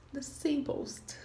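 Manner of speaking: the simplest whispered slowly, barely voiced; soft whispered model of the simplest; whispered repeat. Shown as a demonstration. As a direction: breathe the simplest softly as an echo. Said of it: "nós temos o simple".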